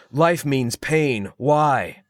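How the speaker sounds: slow, overwrought line reading; bad dramatic acting. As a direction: deliver it like a clumsy tragic hero. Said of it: "randomly he'd say".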